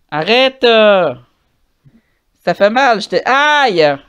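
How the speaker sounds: pained yelp. Real, not acted. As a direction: yelp as something hurts. Hurt, crying out in pain.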